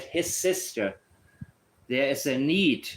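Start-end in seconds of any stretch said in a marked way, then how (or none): 0.0-1.0 HIS said higher and drawn out, sister falling; stress on HIS. Retold or imitated. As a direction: lean on the word His and let sister drop. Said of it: "He said".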